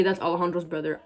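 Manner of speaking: usual speaking voice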